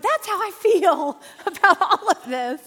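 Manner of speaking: laughing retort. Retold or imitated. Showing the tantrum photo to Patrick, laughing loudly. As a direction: blurt it out half laughing. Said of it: "I pulled this up and I went".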